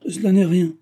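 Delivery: mild scornful dismissal; disdainful. A narrator's reading. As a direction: wave her apology away with contempt. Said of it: "reprit-il dédaigneusement".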